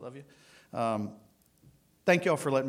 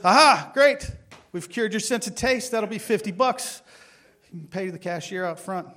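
gleeful engineer; 8 semitones higher, 9 dB louder than usual. Acted. Triumphant engineer in retold joke.

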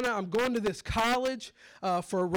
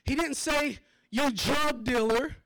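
preaching build; 4 semitones higher than usual. Acted. Preacher to the congregation, building to a shout.

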